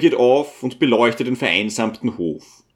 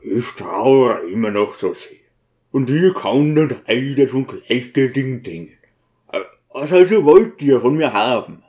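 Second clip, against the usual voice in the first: mournful, weary deacon's voice; understated grief in a gruff character voice; sighing grief. Acted. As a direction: confess lingering sorrow, then protest a little. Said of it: "seufzte er und sagte ganz leise".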